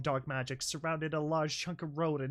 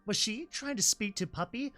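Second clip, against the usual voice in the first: ordinary delivery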